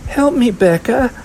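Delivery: put-on high, pleading girl's cry; distressed little-girl plea; crying for help. A narrator's reading. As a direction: call out for help in a high child's voice. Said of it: "she cried".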